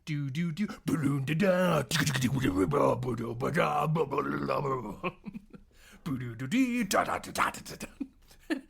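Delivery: rapid nonsense-syllable instrument imitation; mouthed jam riff. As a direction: babble out a frantic bass solo. Imitating the jamming.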